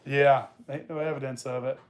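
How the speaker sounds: ordinary talk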